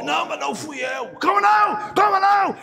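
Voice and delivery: loud, high angry back-and-forth; men shouting in a street fight; retold angry shouting. Golpista e vítima gritando. (gritaria de briga, alta e aguda)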